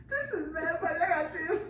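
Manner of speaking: ordinary talk, nothing marked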